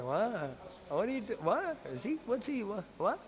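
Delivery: high, hushed puzzled questions; whisper-squeak what what, baffled; confused girls. Retold or imitated. Gopis confused, whispered voices.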